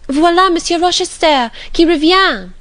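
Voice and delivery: put-on high, loud little girl's cry in French; a child shouting in delight; excited French exclamation of a little girl; excited child's exclamation. A narrator's reading. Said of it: "suddenly exclaimed".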